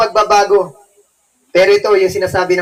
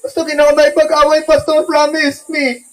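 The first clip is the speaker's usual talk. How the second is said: mock pleading promise; 6 semitones higher than usual. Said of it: "iyak pa kunwari kay Pastor".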